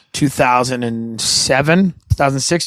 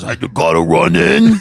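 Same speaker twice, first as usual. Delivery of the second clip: Brian; 3 semitones lower than usual. Retold or imitated. Friend pulls up to his house, strained imitation. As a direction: redo it in the buddy's odd voice. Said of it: "He goes".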